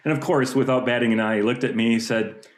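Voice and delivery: usual speaking voice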